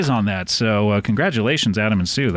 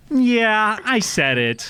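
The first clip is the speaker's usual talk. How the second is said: copying it: raised YEAH, then falling "I said it"; imitated title delivery; 4 semitones higher, much slower than usual. Host imitating a book buyer at the store, raised "Yeah", dropped finish. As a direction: mimic the author, "Yeah" up high, "I said it" dropping. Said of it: "I like to say it like".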